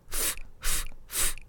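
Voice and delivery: 'ふ' as short voiceless bursts of air, English F; isolated F sound. Shown as a demonstration. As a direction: puff air forward through lip and teeth on 'ふ'. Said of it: "息を前に吹き出す". (「ふ」を息を前に吹き出すFの音で)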